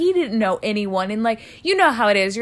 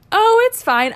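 put-on breezy drunk; 11 semitones higher, 6 dB louder than usual. Drunk self shrugging it off.

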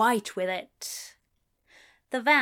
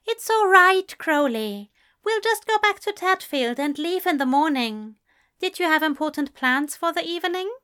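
reassuring; 7 semitones higher, 5 dB louder than usual. A narrator's reading. Aziraphale comforting Crowley.

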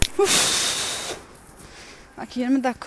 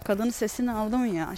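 ordinary delivery

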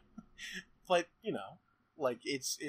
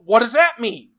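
loud, higher indignant shout; mock outraged yell; 5 semitones higher, 13 dB louder than usual. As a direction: demand it in fake outrage. Mock outrage, much louder.

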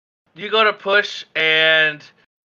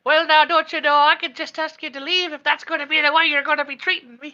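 put-on high, indignant old lady voice; flustered and offended, warning them off; huffy old lady; 12 semitones higher than usual. Red-faced shopkeeper threatens to throw them out, high and huffy. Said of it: "red-faced and huffy".

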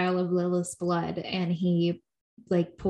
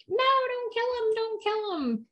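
high pleading mock voice; imitating desperate begging; 10 semitones higher than usual. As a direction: beg in a hammed-up high plea. Retold characters begging for life.

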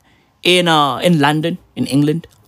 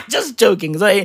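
ordinary talk, nothing marked

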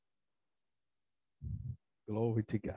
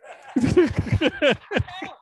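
high, loud burst of laughter; hearty laugh; 13 semitones higher, 4 dB louder than usual. Real, not acted. Preacher tickled by his own joke, hearty laugh. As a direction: crack up at your own joke.

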